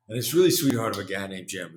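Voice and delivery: usual speaking voice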